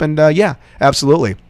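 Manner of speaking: usual speaking voice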